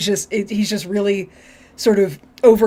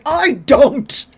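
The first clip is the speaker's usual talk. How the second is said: higher, louder flat refusal; curt Picard; 6 semitones higher, 4 dB louder than usual. Retold or imitated. Host voicing Picard refusing Q, higher and louder. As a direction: shut him down. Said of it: "Picard's like".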